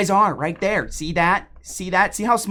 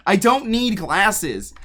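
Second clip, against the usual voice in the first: drawn-out, emphatic denial; slow defensive insistence; 2 semitones higher, 5 dB louder, much slower than usual. Real, not acted. Streamer insisting to chat she needs no glasses, slow and emphatic. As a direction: insist slowly and loudly, stressing don't. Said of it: "defensive retort".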